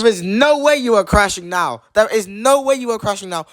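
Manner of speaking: high, fed-up disbelief; venting at a crashing app; exasperated. Real, not acted. Phone app keeps crashing.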